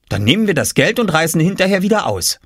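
put-on fast, high child's voice; scheming boy. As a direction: rattle off the plan like a boy. Boy hatches a plan. (schnelle, hohe Jungenstimme)

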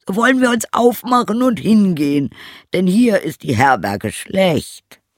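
high, loud donkey voice; rallying donkey character. Acted. Donkey invites the old hound to make music, rallying donkey voice. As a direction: call out the plan high and strong.

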